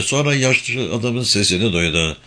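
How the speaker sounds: ordinary delivery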